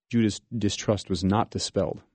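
normal talking voice

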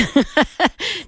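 sudden high-pitched laugh; high burst of laughter. Real, not acted. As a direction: break into a real laugh. Host laughs at listener's emojis.

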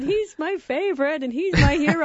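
put-on high, teary boy's voice; imitating tearful son. Mom quoting her crying son defending his brother, high and teary. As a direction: plead like a crying kid brother. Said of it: "Yeah, he said".

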